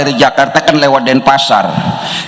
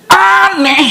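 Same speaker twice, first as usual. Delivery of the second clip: mock astonishment. Exclaiming in mock disbelief.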